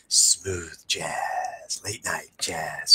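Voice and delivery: low, hushed smooth-jazz DJ voice; late-night DJ. Shown as a demonstration. Coach showing how a calm negotiator talks, hushed late-night DJ. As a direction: purr it like a late-night radio host, slow and low. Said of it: "you have to kinda talk like a D J".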